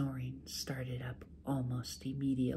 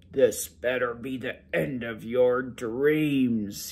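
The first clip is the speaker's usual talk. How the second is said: mumbling ogre; 6 dB louder, slower than usual. A narrator's reading. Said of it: "he mumbled".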